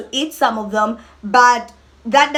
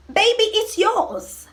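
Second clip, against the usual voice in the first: high sing-song; 9 semitones higher, slower than usual. Real, not acted. Sings out the promise.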